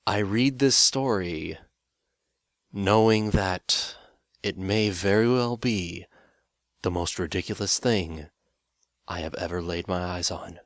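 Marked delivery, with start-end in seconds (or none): 0.1-6.0 slow, louder, each word weighed; slow dramatic build. Acted. Bracing viewers for a terrible story, slow and loud. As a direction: drag it out for dramatic effect.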